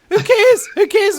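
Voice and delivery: high, loud, scoffing who cares. Real, not acted. Brushing off the question, a high loud who cares. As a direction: brush it off loudly, voice jumping up. Dismissive outburst in chat.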